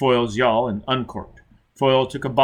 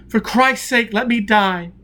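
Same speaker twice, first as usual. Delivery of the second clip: much higher, loud, begging to die; anguished plea; 10 semitones higher, 5 dB louder than usual. A narrator's reading. Tortured man begs Foyle to let him die, anguished. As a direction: cry out in agony.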